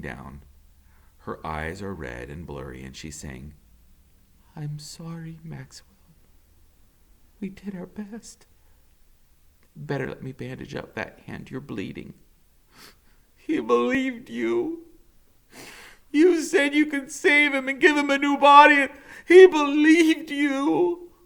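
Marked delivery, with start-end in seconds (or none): 4.6-12.1 a lightly voiced soft doctor, quieter; tired, kind doctor breaking bad news; gentle doctor. Doctor Spivak telling Max the news, soft and gentle. 13.4-21.1 high, loud and slow, near tears; anguished accusation. Acted. Max blaming Doctor Spivak, high loud anguished.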